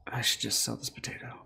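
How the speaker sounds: breathy private whisper to himself. Real, not acted. Tempted by the potato, whispered to himself. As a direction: say it under your breath, conspiring.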